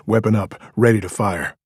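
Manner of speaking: ordinary delivery, nothing marked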